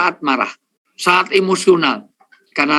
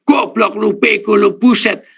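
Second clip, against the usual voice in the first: high, louder burst of insults; acted angry outburst, pitched up; angry yelling; 5 semitones higher, 3 dB louder than usual. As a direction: yell insults like someone furious up close. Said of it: "orang marah itu deket tapi teriak".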